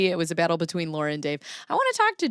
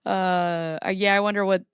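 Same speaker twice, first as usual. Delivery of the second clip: ordinary talk, nothing marked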